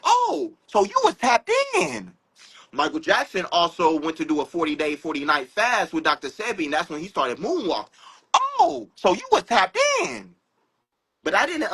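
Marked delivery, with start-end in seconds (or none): 0.0-2.1 high-pitched exclamation of discovery; dawning realization. Acted. Acting out his sudden realization. 8.3-10.3 high, exclaimed catching-on; dawning realization. Acted. Acting out his sudden realization.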